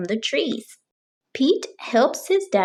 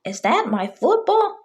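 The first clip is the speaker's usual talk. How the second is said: higher, a touch puzzled; mild mock surprise; surprised Bob; 8 semitones higher than usual. A narrator's reading. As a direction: Bob noticing his ball, lightly voiced. Bob spots his football.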